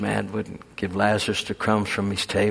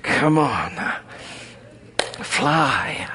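whispered "Fly"; a hushed urging; 4 semitones higher, much slower than usual. Real, not acted. The preacher whispers encouragement to the congregation.

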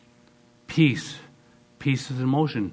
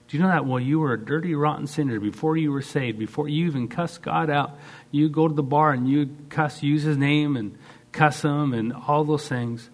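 fiery preaching; 4 semitones higher than usual. Real, not acted. Preaching at full force.

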